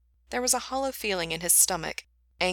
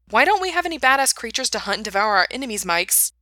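loud, high, fast rant; angry complaint; 5 semitones higher, 7 dB louder than usual. A narrator's reading. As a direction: round on someone with an exasperated demand. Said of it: "He rounded on Mikey".

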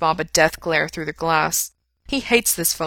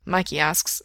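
ordinary delivery, nothing marked

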